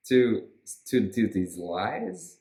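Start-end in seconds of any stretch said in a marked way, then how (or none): none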